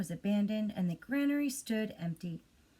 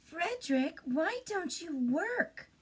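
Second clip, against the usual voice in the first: high, slow little mice asking; high mouse chorus voice; 9 semitones higher, slower than usual. Acted. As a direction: squeak it up like curious mice.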